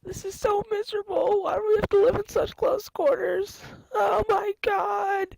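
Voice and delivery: high, drawn-out desperate wails; panicked wailing. Acted. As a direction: wail like someone locked in. Prisoners trapped, wailing.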